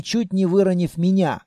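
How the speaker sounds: ordinary delivery, nothing marked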